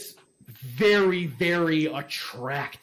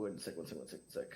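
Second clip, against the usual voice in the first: much softer and lower, under the breath; muttered aside; 12 semitones lower, 18 dB quieter, faster than usual. Real, not acted. GM mutters to himself fixing his setup, very soft. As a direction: mumble it to yourself off mic.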